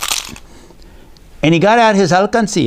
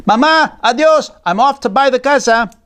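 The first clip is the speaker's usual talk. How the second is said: put-on higher, eager boy's voice, a light touch; young Diego; 8 semitones higher than usual. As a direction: play the excited little boy. Boy Diego bids his mother goodbye.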